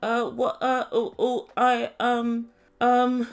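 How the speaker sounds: flustered string of broken false starts; mock nervous stammering. Leandro dodging Binyam's question, mock nervous stammering. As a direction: stall and stumble, imitating someone caught out. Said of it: "really nervous when I asked him".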